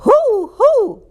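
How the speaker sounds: two high, hollow hoots; owl hoot. A narrator's reading. Owl flying over the stable, high hoots. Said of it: "hooted Owl".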